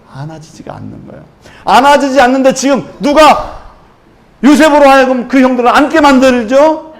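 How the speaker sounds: loud, high, impassioned preaching; full-force preaching. Acted. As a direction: preach it out, building with fervour. Voicing Joseph at full force. (힘차게 외치는 설교)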